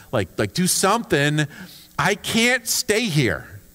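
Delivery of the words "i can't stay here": drawn-out, pleading and slow; slow desperate plea. Pretend. Trapped prisoner begging friends, slow and pleading. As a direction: beg to get out, dragging each word. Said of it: "that's what I'd be feeling".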